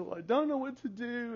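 high-pitched imitation of an upset daughter; mock helpless wail. Crying daughter at a loss, acted.

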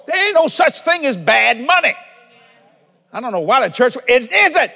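loud, high-pitched sermon delivery; full-force preaching. Real, not acted. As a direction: proclaim it to the whole congregation, pounding each point. Preaches at full force about money.

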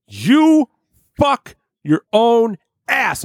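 high then very slow taunt; mocking retort. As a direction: drag the taunt out slowly. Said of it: "And I said".

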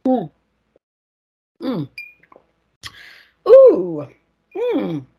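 savoring hums between sips; tasting murmurs; while sipping a drink. Real, not acted. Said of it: "Sipping is happening".

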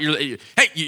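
ordinary delivery

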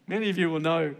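normal talking voice

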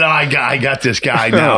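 a touch of high-pitched triumph; gleeful confidence. Retold or imitated. Con man telling himself the buyer is hooked, mildly gleeful. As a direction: crow lightly over a sure win.

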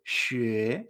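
学 drawn out, climbing from low; rising tone on 学. Shown as a demonstration. Teacher tells learner to notice, 学 slowly rising. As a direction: stretch 学 into a slow upward glide.

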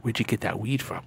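low, hushed question; furtive ask. Retold or imitated. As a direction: ask discreetly under your breath. Covertly asking homeless man.

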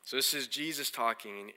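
ordinary talk

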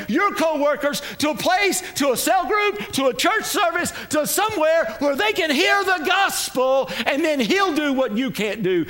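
ringing exhortation to act; fervent preaching. Real, not acted. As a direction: preach it hard to rouse the congregation. Preaches at full force.

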